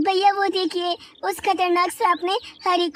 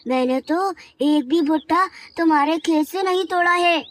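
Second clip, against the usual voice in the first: ordinary delivery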